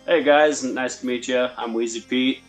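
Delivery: ordinary delivery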